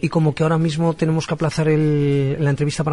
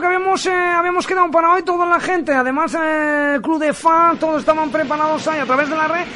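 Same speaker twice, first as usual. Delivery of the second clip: bright, raised on-air host voice; radio announcer; 9 semitones higher than usual. Acted. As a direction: sell it like a live radio host.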